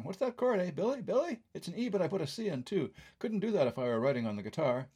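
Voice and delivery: a quick half-hearted George impression; imitating [name]. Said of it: "Can't do the Liverpool accent, sorry".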